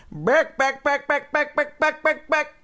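high, drawn-out home run call; sportscaster call. Retold or imitated. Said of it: "the guy that goes".

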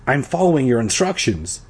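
ordinary delivery

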